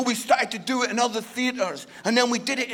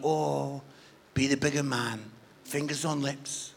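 very low, hushed divine voice; voice of God; 9 semitones lower, 8 dB quieter than usual. Retold or imitated. God holding the preacher back from retorting, low quiet restraint. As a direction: murmur a low, restraining word.

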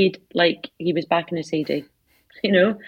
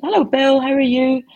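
raised, louder voice calling hello; hearty greeting; 7 semitones higher, 5 dB louder than usual. Retold or imitated. Visitor hailing Dad in the care home hall, hearty greeting. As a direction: greet him loudly, pitched up high.

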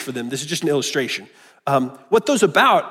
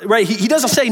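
ordinary talk, nothing marked

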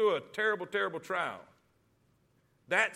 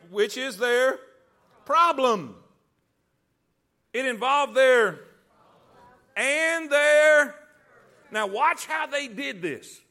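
forceful preaching; 6 semitones higher, 9 dB louder than usual. Real, not acted. Preacher calling the congregation to repeat key words, forceful raised preaching. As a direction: drive each phrase home to the congregation, high and loud.

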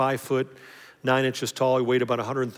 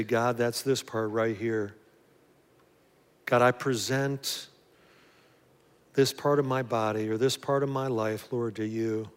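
closing prayer; 2 semitones lower, 4 dB quieter than usual. Acted. Pastor leading listeners in prayer, soft and earnest. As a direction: pray it gently for the congregation.

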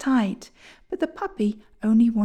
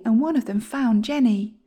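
ordinary delivery

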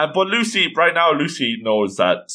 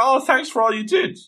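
put-on much higher, girlish character voice; high-pitched thanks; 12 semitones higher than usual. Lucy thanking Natsu and Happy, in a high voice.

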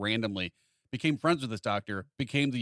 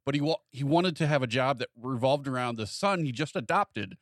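ordinary delivery

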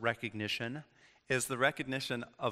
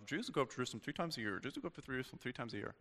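imitating a friend; 9 dB quieter, faster than usual. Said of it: "he was like, you know".

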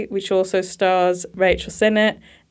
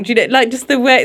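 usual speaking voice

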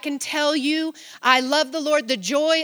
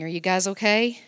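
usual speaking voice

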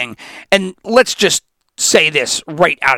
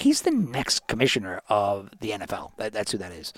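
softer, reverent office hush; hushed reply; 7 semitones lower, 10 dB quieter than usual. Retold or imitated. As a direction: tell him quietly who the boss is. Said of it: "Why are we all whispering here".

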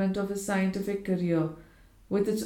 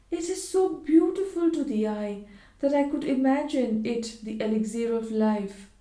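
lightly voiced wife, higher; admiring young wife; 4 semitones higher than usual. A narrator's reading. Wife admires the globe to her husband, lightly voiced and higher. Georgiana's voice in narration.

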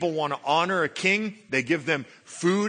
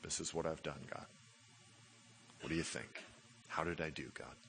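much softer, small voice presenting garbage; sheepish plea; 10 semitones lower, 19 dB quieter, faster than usual. Retold or imitated. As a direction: sound embarrassed before God. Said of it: "us standing before God ... going".

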